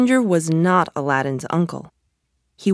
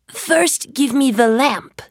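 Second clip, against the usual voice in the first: demanding magician; 7 semitones higher, slower than usual. A narrator's reading. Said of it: "the magician answered".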